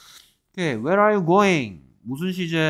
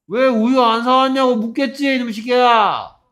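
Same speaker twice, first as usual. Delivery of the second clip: high, loud frustrated scolding; exasperated shout; 8 semitones higher, 9 dB louder than usual. Real, not acted. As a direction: yell it at a hopeless pupil. Teacher scolds students with correct answer. (답답해하며 높게 버럭 호통)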